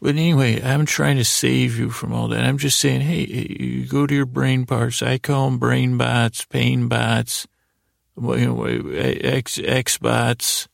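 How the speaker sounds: usual speaking voice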